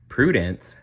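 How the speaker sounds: plain reading voice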